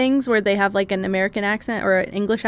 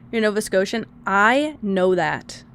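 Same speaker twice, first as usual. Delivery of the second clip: ordinary talk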